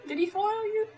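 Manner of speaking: repeated through laughter, high; laughing. Real, not acted. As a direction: tease again while laughing.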